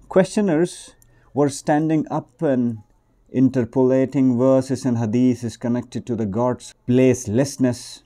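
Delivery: loud, emphatic reading-aloud voice; story reading. Acted. Reader tells of preacher answering questioners, loud emphatic read-aloud. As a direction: project the written tale to your listeners.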